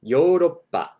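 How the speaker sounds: ヨーロッパ; Japanese Yoroppa. Shown as a demonstration. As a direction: Yoroppa as yo-rop-pa. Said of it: "If you want to say Europe".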